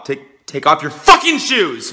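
loud, slow angry yell; sudden outburst. Acted. Host orders guest's shoes off, loud snap. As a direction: blow up at the guest mid-welcome.

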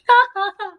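short high bright laugh; a little burst of delighted laughter; blithe laugh. Acted. Dot laughing at John, high and bright. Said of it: "laughed Dot".

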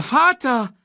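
calling out. A narrator's reading. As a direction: call after falling father. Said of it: "rief Simba". (Simba ruft verzweifelt laut)

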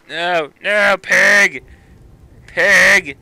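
shouting in alarm mid-game; alarmed yelling. Real, not acted. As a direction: yell at an attacker to back off. Yelling at attacking game pig.

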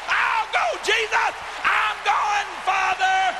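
high ringing shout to heaven; crying out. Acted. Preacher to the crowd, shouting his prayer high. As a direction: call it out high and loud.

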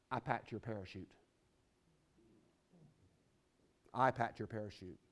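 low, hushed and deliberate; quiet solemn reveal. Retold or imitated.

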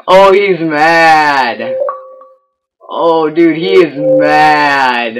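slow, drawn-out taunting; mocking gloat. Real, not acted. Gamer taunting a sore loser, slow and mocking.

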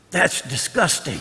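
low, slow, hushed mock disgust; disgusted aside. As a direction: draw it out quietly, like a disgusted kid brother. Brother's disgusted reaction, retold.

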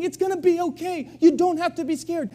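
rally frightened people with bright urgency; excited encouragement. Retold or imitated. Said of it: "they're saying".

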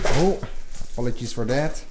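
ordinary talk, nothing marked

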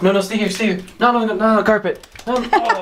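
rising panicked no no no; alarmed high no. Real, not acted. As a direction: stop the dog in a hurry, pitch climbing. Dog runs onto carpet.

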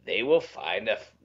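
low, slow sinister rasp; gloating old villain; Emperor voice. Pretend. Quoting Emperor scene.

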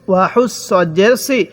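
ordinary talk, nothing marked

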